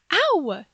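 spanked woman yelping again; pained yelp. Acted. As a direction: yelp at the next slap. Being spanked.

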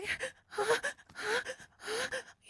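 high loud cry-laugh. Acted. Laugh at climax.

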